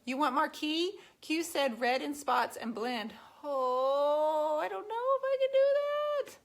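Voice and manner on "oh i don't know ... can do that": hammed-up wail, pitched higher; mock cry. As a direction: fake a sob over the red paint. Fake despair over red paint.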